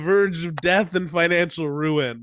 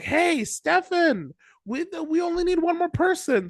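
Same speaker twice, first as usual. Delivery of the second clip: mock pleading; 12 semitones higher than usual. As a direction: squeak it out like desperate beggars. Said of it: "they're like".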